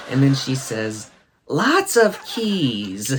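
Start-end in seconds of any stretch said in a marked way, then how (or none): 1.5-3.1 higher, very slow, laughing lots of keys; imitating her. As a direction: draw it out high like a delighted kid. Quoting her answer.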